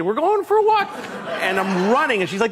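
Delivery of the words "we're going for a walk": very high, gleeful dog voice; giddy, high-pitched pet excitement; excited dog. Retold or imitated. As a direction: squeal it like a dog thrilled about a walk. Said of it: "She's, like, excited".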